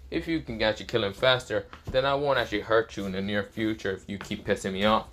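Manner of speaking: ordinary delivery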